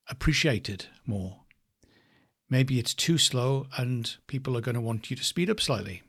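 ordinary talk

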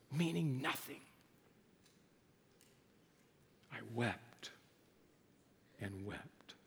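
falling to a low near-whisper; fading hush. Acted. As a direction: let the quote fade to nothing. Fading end of quote.

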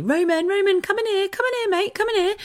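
high calling voice for her son. Retold or imitated. Mum calling her toddler from the bathroom, high call. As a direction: call him in, high and bright.

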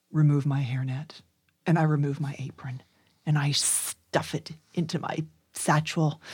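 soft whispered narration; whispering. Acted. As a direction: breathe it out quietly. Quietly undressing.

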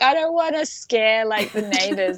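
high-pitched, frantic worrying during labor; delirious worry. Retold or imitated. Mother in labor fretting about neighbors, delirious. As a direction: fret aloud, half out of it. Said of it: "in my, like, delirious state".

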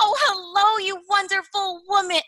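high, sing-song coo aimed at herself. Pretend. Cooing kindly to herself the way she never would. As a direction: gush over yourself like a baby. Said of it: "I would never say to me".

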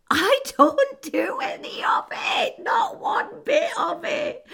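high, loud laughing cry; laughing shriek. Real, not acted. Admitting she didn't talk to men, laughing shriek.

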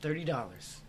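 hammed-up announcer plug; ad read. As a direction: sell it like a pitchman. Sponsor ad read.

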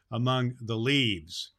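usual speaking voice

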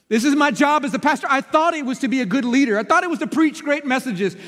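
high, loud, driving sermon build; preaching at full force. Acted. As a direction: preach it up to the rafters. Preaching climax.

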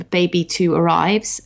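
usual speaking voice

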